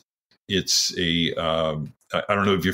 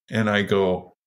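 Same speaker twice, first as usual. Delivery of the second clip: ordinary delivery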